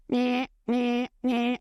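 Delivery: imitated alarm bleat, evenly repeated; alarm impression. Shown as a demonstration. Speaker mimics the biggest alarm bell, alarm impression. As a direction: mimic an alarm going off in short, identical bleats. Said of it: "That's my best impression".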